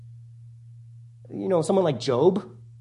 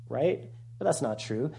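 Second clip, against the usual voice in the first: ordinary talk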